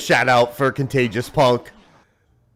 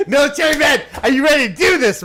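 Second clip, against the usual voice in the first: laughing; 14 semitones higher, faster than usual. Real, not acted. Laughs while calling the guest.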